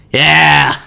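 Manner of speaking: loud excited yeah; excited shout. Real, not acted. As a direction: cheer it out. Excited about weed chips.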